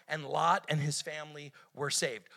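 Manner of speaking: ordinary delivery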